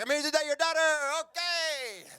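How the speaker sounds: very high, hammed-up festive shout; maracas-shaking caricature. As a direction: burst in like a cartoon party entertainer. Imagined caricature arriving with maracas.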